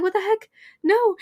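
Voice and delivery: high-pitched retold disbelief; flustered mock protest. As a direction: protest high, playing up the shock. Flustered retold reaction to flirting.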